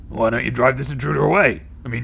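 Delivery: normal talking voice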